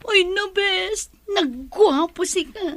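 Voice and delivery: high, slow, swooning squeal over a handsome man; giddy swooning. Acted. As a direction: gush like a smitten girl.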